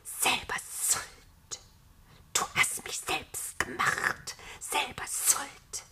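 slow, voiceless snake whisper; hissing. A narrator's reading. The Nameless throwing blame back at God, hissing. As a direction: hiss it slowly and softly, stretching the sibilants. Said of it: "zischte das Namenlose".

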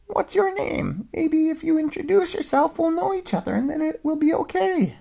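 high, tiny mouse voice; little voice. Acted. Mouse answering the old dog, tiny and high. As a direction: squeak it out small.

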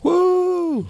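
high excited whoop; excited cheer. Real, not acted. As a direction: cheer like a crowd. Cheering the guest in.

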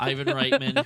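squeaky laugh-crying; high, loud laughing through the name. Real, not acted. Still cracking up after stumble.

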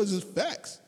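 usual speaking voice

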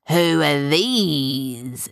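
very slow, drawn-out pretend pirate challenge; fierce pirate act. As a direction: play the menacing pirate, stretching the words. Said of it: "switching back into his fierce pirate mode".

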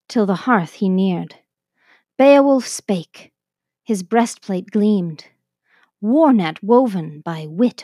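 slow, grand epic recitation; poem recitation. Acted. Teacher reciting the Beowulf translation to viewers, solemn and epic. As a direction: declaim it like an old heroic saga.

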